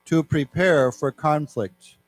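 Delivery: careful, slow reading of a news quote; measured classroom reading; slow read-aloud. Shown as a demonstration. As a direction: read it out slowly for learners.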